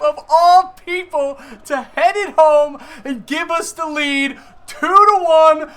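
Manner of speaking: real screaming play-by-play of a late goal; screaming goal celebration. Real, not acted. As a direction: scream it like your team just scored the winner. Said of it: "sorry again for your eardrums with me screaming".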